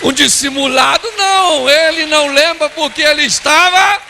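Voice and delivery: high, loud, building exclamation; mock outrage. Preacher correcting himself about the husband, loud mock outrage. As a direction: belt it out in comic indignation.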